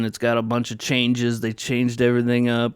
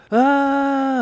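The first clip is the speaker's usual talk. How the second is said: much higher, louder mock shriek; mock scream; 4 dB louder than usual. Retelling the scared characters with a mock shriek. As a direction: yelp like you've seen the Terminator. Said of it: "they just go".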